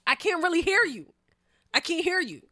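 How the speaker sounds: high, loud, as over noise; feigning deafness. Retold or imitated. Dodging the man's questions in retelling, loud feigned deafness. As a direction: raise pitch and volume, pretending you can't hear. Still faking not hearing the man.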